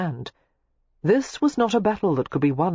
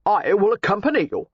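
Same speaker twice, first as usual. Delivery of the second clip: decisive; 5 semitones higher, 5 dB louder than usual. A narrator's reading. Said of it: "Thomas Bowman said decisively".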